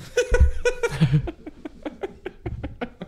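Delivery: long, high, quiet giggle; high helpless chuckling; real laughter. Real, not acted. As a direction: wheeze out a drawn-out laugh. Laughing after being called bad person.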